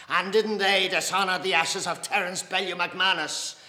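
loud, high volley of accusing questions; angry tirade. A narrator's reading. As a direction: hammer each accusation home in anger. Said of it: "His face was glowing with anger".

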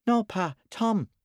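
put-on high child's voice; high-pitched little son replying; little boy. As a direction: answer Pa as a small boy. Little boy answers his father.

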